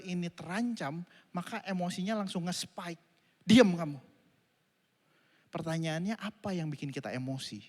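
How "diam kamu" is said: angry snap. Retold or imitated. Preacher replays his outburst.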